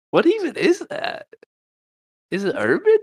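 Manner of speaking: high mock voice. Mocking the odd accent voice.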